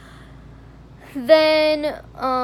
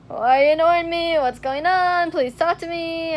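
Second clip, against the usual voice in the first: louder, higher desperate plea; pleading; 5 semitones higher, 5 dB louder than usual. Retold or imitated. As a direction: beg him to stop ignoring you. Pleading with ignoring friend.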